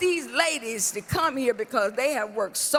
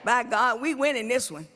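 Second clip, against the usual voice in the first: preaching; 4 semitones lower than usual. Acted. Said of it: "I'ma act like I'ma preach".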